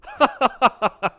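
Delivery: high staccato cackle in a put-on voice; silly high-pitched laugh; character laugh.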